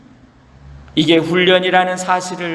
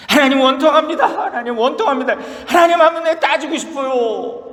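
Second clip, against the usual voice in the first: Job's protest; 8 semitones higher than usual. Acted. Job crying out his grievance to God, high anguished protest. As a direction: plead your grievance, voice straining upward. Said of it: "이렇게 항변했어요".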